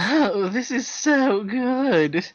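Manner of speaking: high, slow, moaning voice; hammed-up moan of pleasure. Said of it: "mooned the desk lady".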